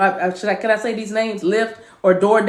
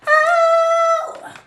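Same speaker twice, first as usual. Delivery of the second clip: very high sung ad-lib cry; high falsetto whoop. Pretend. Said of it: "Remember you sang that?".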